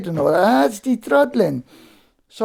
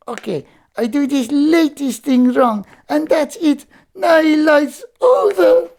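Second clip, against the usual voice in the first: drama queen; 6 semitones higher, slower than usual. Acted. Puppet Sergio moans that his life is over, hammed-up. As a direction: wail it like it's the end of the world. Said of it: "Don't be such a drama queen".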